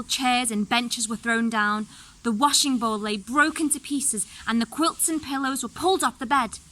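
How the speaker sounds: plain reading voice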